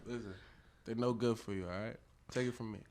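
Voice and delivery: ordinary talk, nothing marked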